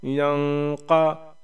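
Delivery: यंक़ा with a long nasal hum before क़; slow humming nasal in यंक़ा; nasal ghunna. Shown as a demonstration. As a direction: hold यं in the nose, then क़ा. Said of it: "same उसी हिसाब से".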